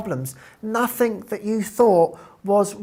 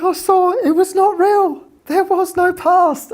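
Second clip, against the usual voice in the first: much higher and louder, awed; elated quote; 12 semitones higher, 6 dB louder than usual. Retold or imitated. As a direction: exclaim the revelation in wonder. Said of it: "She's like".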